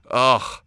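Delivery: loud, disgusted groan; disgusted ugh. Retold or imitated. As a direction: groan it like the idea is gross.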